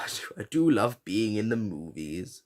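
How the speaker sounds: lightly voiced old film star, a touch of a quaver; a hint of the aged Hepburn, savouring each word; Katharine Hepburn impression. Podcaster quoting the Hepburn bit, lightly imitating her. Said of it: "funny as Katharine Hepburn".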